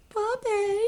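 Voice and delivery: squeal. Real, not acted. Delighted at puppy card.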